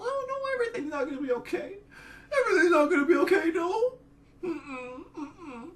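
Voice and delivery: fake-weep it like a wailing child; mock sobbing. Mock despair at Pence presidency.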